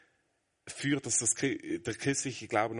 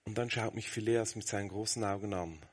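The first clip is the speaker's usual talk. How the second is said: ordinary talk, nothing marked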